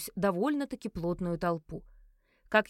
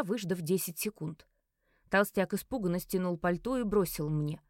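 plain reading voice

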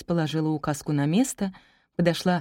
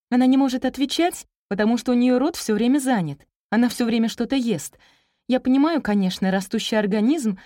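higher, earnest explaining; eager young teacher, apologetic; 6 semitones higher, 4 dB louder than usual. Acted. Teacher explaining to a mother her girl always eats, pleading. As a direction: plead politely for the parent's understanding.

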